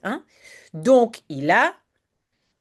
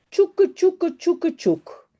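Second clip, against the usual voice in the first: train noise; 10 semitones higher, much slower than usual. Acted. Train departs.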